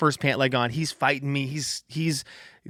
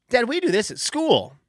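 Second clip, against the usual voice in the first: high, sweet little-girl voice imitating daughter; sweetheart voice; 9 semitones higher than usual. Dad imitating daughter's sweet reply, high and sweet. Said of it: "this sweetheart voice".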